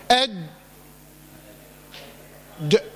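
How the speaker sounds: أد shut and held silent, then ج- let go; long blocked closure after أد before a short ج- bounce; jeem held then released. Shown as a demonstration. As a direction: hold the stop in أد, release ج- late. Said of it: "أنا بجيب الشدة والجهر اللي في الحرف".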